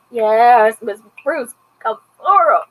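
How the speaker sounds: cheering on. Real, not acted. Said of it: "I was like".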